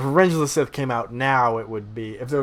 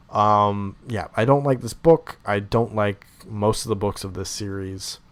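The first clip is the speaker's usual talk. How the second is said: ordinary delivery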